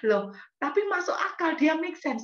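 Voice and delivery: high, surprised admission. Real, not acted. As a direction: sound caught off guard by the point. Surprised concession. (nada tinggi terkejut)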